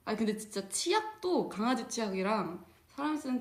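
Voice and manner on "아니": ordinary talk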